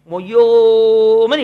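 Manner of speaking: loud, long-held mock wail; imitated child's bawl. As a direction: bawl "moyyo" like a crying child. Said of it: "ఏడుస్తాడు".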